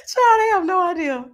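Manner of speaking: high, loud words through laughter; amused. Real, not acted. Host amused by clueless followers, laughing. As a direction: say it still cracking up.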